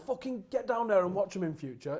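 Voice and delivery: retold angry yell. Fergie tearing into Pallister after Neville's debut, with a loud mock yell. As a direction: bawl it out like a raging manager. Manager rants in dressing room.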